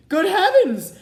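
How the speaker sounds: very high, loud exclamation; startled. A narrator's reading. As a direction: gasp it out in shock. Fairy sees the ragged dress.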